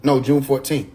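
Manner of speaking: ordinary talk, nothing marked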